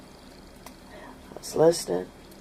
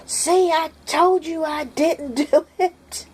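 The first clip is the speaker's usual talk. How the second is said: put-on vindicated boy; 13 semitones higher, 3 dB louder, slower than usual. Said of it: "my son goes".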